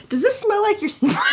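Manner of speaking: high, quick joke blurt; mock blurting question. Reader joking as Han to Luke, high mock blurt. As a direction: fire it off high and fast, on the edge of laughing.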